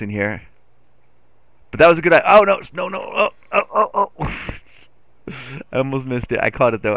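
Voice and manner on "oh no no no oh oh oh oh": high, worried little oh-nos; mild panic. Real, not acted. Gamer nearly misses the turn, high and panicky. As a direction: fret in a rising string of yelps.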